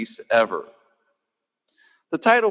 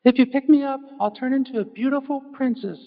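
much higher tiny voice; little frog voice; 14 semitones higher than usual. Acted. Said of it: "frog says".

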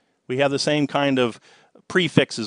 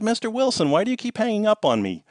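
a lightly raised, pushy caller voice; imitated caller; 10 semitones higher than usual. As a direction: play the persistent scam caller, a little higher. Scam caller voiced again.

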